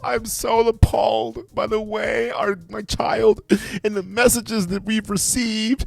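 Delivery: high, slow mock apology voice; mocking imitation. Reactor quoting the mother's apology back, high slow mockery. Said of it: "So you're talking about".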